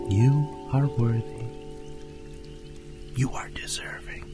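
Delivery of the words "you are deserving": soft, hushed whisper; whispered affirmation. Acted. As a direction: whisper it as quiet encouragement. Affirmation to listener.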